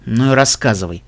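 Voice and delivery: low, mildly sulky retort; huffy snort. A narrator's reading. Brother turns away from his blackmailing sister, huffy. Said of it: "фыркнул он".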